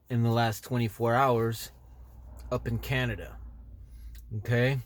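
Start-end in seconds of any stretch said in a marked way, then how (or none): none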